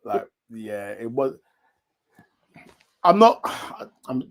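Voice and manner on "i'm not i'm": halting false starts with a sigh; start, break off, sigh and restart; stumbling for careful words; stuttering. Real, not acted. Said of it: "I'm stuttering".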